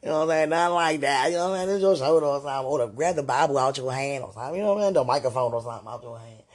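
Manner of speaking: flustered backpedaling. Real, not acted. Hastily explaining away the word grab.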